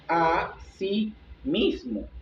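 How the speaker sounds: "así mismo" drawn out slowly as two words; "así mismo" despacio, separando las palabras; slow, split words. Shown as a demonstration. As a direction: spell it out by ear, slowly, "así" then "mismo". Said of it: "se escriben juntas o separadas".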